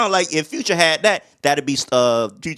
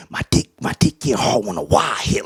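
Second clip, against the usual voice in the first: quoting [name]; 7 semitones lower than usual. Retold or imitated. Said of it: "what that boy say".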